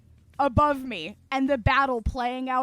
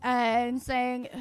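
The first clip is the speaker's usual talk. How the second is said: normal talking voice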